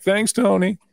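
high, bright sarcastic thank-you, pitched well up; tossing a cheeky thanks back at him; mock gratitude. Retelling a fake thanks to Romo, in a high sarcastic lilt.

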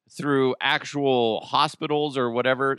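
ordinary talk

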